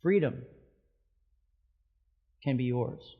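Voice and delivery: ordinary talk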